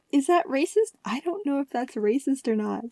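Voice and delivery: unsure, half-laughing; amused doubt. Real, not acted. Laughing doubt at rice field joke.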